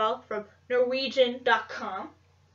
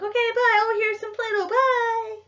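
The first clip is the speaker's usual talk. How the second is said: high, loud, hammed-up sobbing farewell; fake-cry your goodbye, much higher and louder; mock tearful goodbye; 11 semitones higher, 7 dB louder than usual. Leaving her little ones the Play-Doh, in a hammed-up sob. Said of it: "I'm like".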